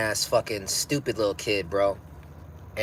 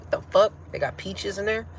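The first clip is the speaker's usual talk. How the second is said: high, incredulous double take; shocked disbelief; 10 semitones higher than usual. Retold or imitated. Shopper stunned by a fancy chocolate bar, pitched high in disbelief. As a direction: react like the price tag just slapped you. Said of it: "You're like".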